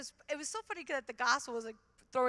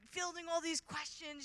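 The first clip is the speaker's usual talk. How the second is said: usual speaking voice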